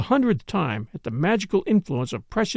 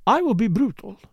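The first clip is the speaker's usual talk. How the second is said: put-on slightly higher accented dancer voice; blunt foreign dancer; 5 semitones higher than usual. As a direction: lightly play the dancer being frank.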